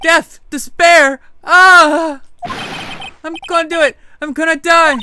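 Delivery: high, loud, hammed-up despair; wailing Larry. Larry howling in the lobby that his life is over. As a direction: throw your arms up and wail.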